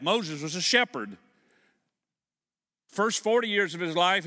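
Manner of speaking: loud, high sermon delivery; rising Sunday preaching; full-force preaching. Real, not acted. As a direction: preach it out to the pews.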